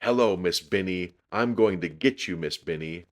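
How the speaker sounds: ordinary delivery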